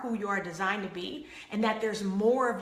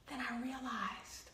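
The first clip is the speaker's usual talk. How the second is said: gasped whisper; 15 dB quieter than usual. Real, not acted. Revealing insight to audience, gasp and whisper. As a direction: gasp then whisper softly.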